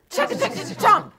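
chugging noise. Shown as a demonstration. Eating noise in story.

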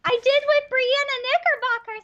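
high-pitched mimicry of a naive follower; mock victim voice. Mimics a future murder victim.

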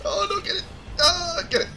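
high-pitched gamer alarm; urgent egging on. Real, not acted. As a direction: urge them on, voice shooting up. Urging on during game fight.

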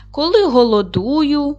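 a hint of a gruff wolf; wolf character. Teacher reading the tale, wolf answers the dog lightly voiced.

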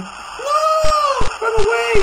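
frantic high cry; panicked scream. Real, not acted. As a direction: scream to flee in terror. Fleeing in game.

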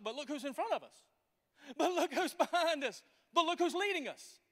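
building from calm to forceful; emphatic Moses. Acted. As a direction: answer them, hitting each "look who's". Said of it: "Moses is like".

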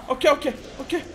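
normal talking voice

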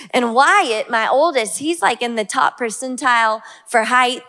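ordinary talk

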